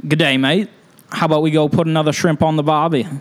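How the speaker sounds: put-on Aussie accent, a touch lifted; Australian accent. Shown as a demonstration. Husband trying Aussie phrases on request, Australian accent. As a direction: have a game try at an Australian accent. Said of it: "Can you try?".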